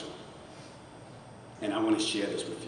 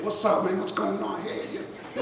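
macho man; 10 semitones higher, slower than usual. Retold or imitated. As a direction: play it like a swaggering macho guy. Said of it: "this macho man".

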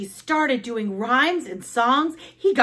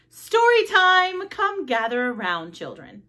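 announcing; 6 semitones higher, 4 dB louder than usual. Acted. Librarian announces story time, loud high call. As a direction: call the children in, stretching each word. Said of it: "Miss Otterbottom announced".

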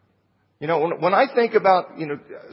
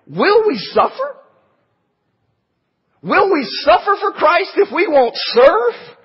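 rising challenge; 9 semitones higher, 3 dB louder than usual. Real, not acted. Preacher challenges congregation rhetorically.

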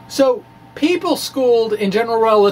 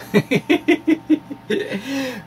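real laugh; 6 semitones higher than usual. Real, not acted. Laughs at his own joke.

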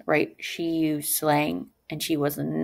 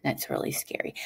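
breathy whispered aside; 9 dB quieter than usual. Real, not acted. Teacher whispering to the class how scary the threat is. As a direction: whisper it like it's spooky. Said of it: "whispered comment".

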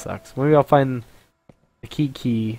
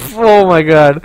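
scared laughter; 6 semitones higher, 13 dB louder, slower than usual. Real, not acted.

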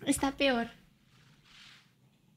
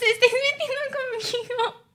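higher-pitched, put-upon wail; whiny complaint; 7 semitones higher than usual. Real, not acted. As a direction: whine that they are picking on you. Repeats the complaint as a whine.

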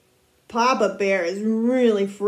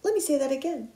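usual speaking voice

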